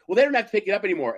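fast, high, loud brush-off; dismissive clerk. Retold or imitated. Teller mimics clerk brushing him off, fast and loud. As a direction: rattle it off dismissively.

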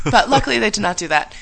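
ordinary talk, nothing marked

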